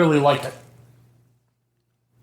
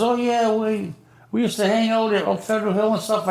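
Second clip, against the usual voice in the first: higher, overly friendly greeting; eager old acquaintance; 8 semitones higher than usual. Retold or imitated. Guy greeting Jerry in front of his girl, high and chummy. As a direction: fake buddy-buddy hello.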